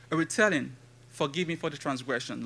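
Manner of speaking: ordinary talk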